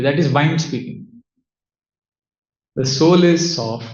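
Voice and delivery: ordinary talk, nothing marked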